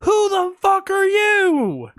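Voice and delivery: hammed-up incredulous squeal; exasperated disbelief. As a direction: exaggerate the baffled outrage. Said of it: "And I'm like".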